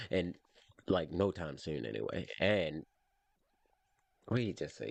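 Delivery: usual speaking voice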